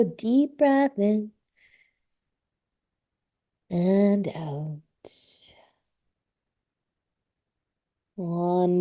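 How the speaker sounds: slow, drawn-out yoga teacher voice; guided yoga relaxation. Real, not acted. Yoga teacher settling a class into child's pose, calm guided relaxation. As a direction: ease them into rest with long, lingering words.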